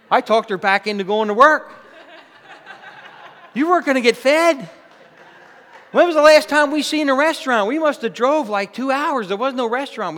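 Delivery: high, loud, punchy preaching; preaching at full force. Acted. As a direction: hammer the point home to the congregation. Preaching climax.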